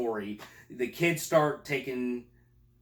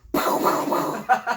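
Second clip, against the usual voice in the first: loud burst of imitated gunfire; explosive pow-pow sound effect; mouthed gunshots; 10 semitones higher, 7 dB louder than usual. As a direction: fire off gun noises with the mouth. Imitating gunfire in closet scene.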